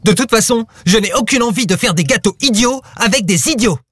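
high-pitched angry tirade. A narrator's reading. Lou refusing to bake with his friends, furious. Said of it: "très en colère".

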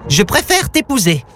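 high-pitched lovestruck lady monster; cooing yeti. A narrator's reading. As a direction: coo it in a high creature voice. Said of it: "roucoula demoiselle Yeti".

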